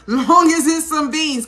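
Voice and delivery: high, loud laughing emphasis, slowly. Real, not acted. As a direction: laugh through it and lean on the beans. Laughing repeat about beans.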